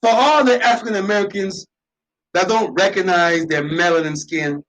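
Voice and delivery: usual speaking voice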